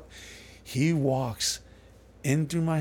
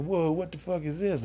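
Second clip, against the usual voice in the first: startled lawyer; 7 semitones higher than usual. Retold or imitated. Lawyer pops out of bathroom.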